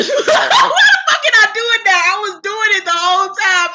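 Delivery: loud, high words through laughter; cracking up. Real, not acted. Host baffled by own giggles, loud laughing voice. Breaks up after impression.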